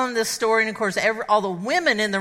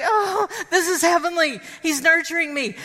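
blissful; 6 semitones higher than usual. Retold or imitated. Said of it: "I was like".

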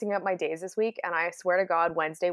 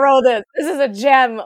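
smug pride; 5 semitones higher, 10 dB louder than usual. Retold or imitated. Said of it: "I was like".

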